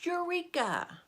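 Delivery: very high, stretched-out exclamation; shouted discovery. A narrator's reading. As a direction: cry it out high and long, like a great discovery. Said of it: "a famous scientist named Archimedes shouted".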